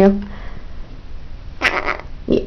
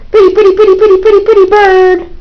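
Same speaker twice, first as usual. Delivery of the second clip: high sing-song demo; 10 semitones higher, faster than usual. Shown as a demonstration.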